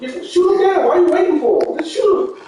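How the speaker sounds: loud, high, frustrated shouting; shouting at his friend who won't fire; exasperated yell. Real, not acted. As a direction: yell at him to just shoot. Frustrated yelling at gaming friend.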